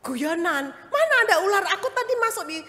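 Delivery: high, loud mock disbelief; husband scoffing on the phone. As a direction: play the husband brushing it off. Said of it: "Dia bilang".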